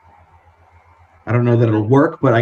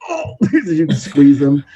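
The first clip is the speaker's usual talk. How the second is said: squeezed; 4 semitones higher, slower than usual. Acted. Hugged hard by strong friend.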